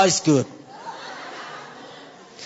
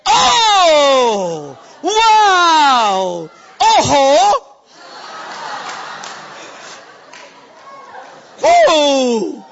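excited exclamation; 3 semitones higher, much slower than usual. Retold or imitated. King David exclaiming in delight at God's goodness. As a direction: cry out in wonder. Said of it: "He said".

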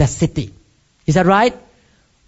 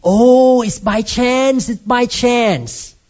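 higher, slow, dismissive mock objector; mocking skeptic; 6 semitones higher, much slower than usual. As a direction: drag out the doubter's brush-off, slowly. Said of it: "people may still argue that".

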